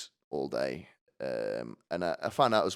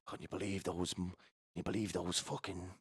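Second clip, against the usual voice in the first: softer, disgusted aside to a seatmate; imitated snooty passengers; 4 dB quieter than usual. Seated passengers on standing ones, hushed mock disgust. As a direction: gossip under your breath about fellow passengers. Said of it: "they look at each other".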